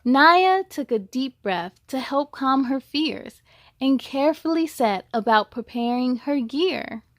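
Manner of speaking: rhyming storybook read with a steady lilt; picture-book narration; reading to children. A narrator's reading. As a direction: read it to a class of kids. Reads a picture book aloud.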